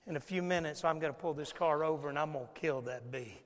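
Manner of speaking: soft, settled fatherly promise; smiling reassurance. Acted. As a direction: reassure the kids with a calm smile. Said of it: "You'll finally smile and say".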